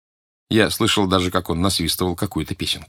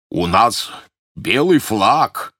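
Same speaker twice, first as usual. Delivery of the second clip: shouting; 2 semitones higher, much slower than usual. A narrator's reading. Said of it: "крикнул Сильвер".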